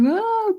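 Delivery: high-pitched mock wail; imitated crying wail. Retelling crying at the driver, high mock wail. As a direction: act out a crying scream. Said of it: "crying, screaming".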